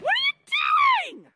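very high, alarmed yell; real shrieked demand. Real, not acted. Mother to son with the TV in the driveway, shrieked demand. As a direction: shriek it in disbelief.

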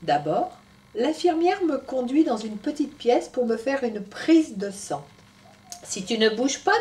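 put-on lively storytelling voice for children; story narration. A narrator's reading. Storyteller telling Tom's story to children, animated. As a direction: read it to little ones.

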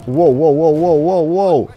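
loud, rising startled whoas; alarmed whoa. Real, not acted. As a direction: blurt them out, caught off guard. Sudden chaos in battle.

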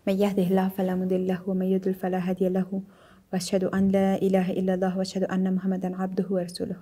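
even, monotone recited opening; measured Arabic religious preamble; formal Arabic invocation. Real, not acted. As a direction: recite the sermon opening evenly on one note.

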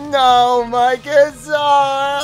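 high loud slow wail. Acted. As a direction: wail over the guitar. Guitar broken again.

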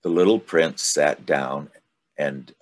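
ordinary delivery

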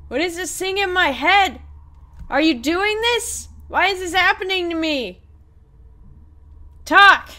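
agitated demand. Acted. Character confronts the ghost with the thing in their head, agitated and loud.

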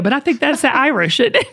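ordinary delivery

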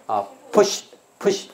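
push，push slowly drawn out; teacher dragging out push; slow demo. Shown as a demonstration. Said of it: "push whip".

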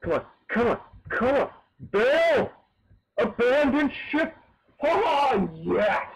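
high, loud game-panic yell into triumph; excited shouting. Real, not acted. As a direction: shout the alarm, then cheer. Frantic escape then triumph.

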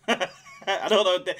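ordinary delivery

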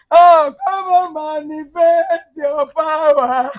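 slow, high, wailed prayer; crying. Real, not acted. Woman begs Jesus to intervene, crying. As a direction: draw the plea out in tears. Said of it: "You have started again".

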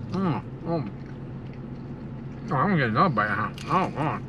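normal talking voice

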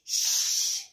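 long hushing hiss; shushing. A narrator's reading. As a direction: shush the child. Dad shushes Daisy.

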